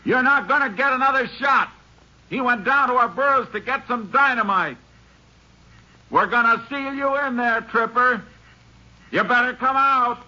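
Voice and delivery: high, carrying calls across the open shelf; calling out. Acted. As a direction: shout terms of surrender to the hidden gunman. Bargaining shouted across to the mine.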